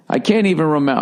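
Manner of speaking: ordinary talk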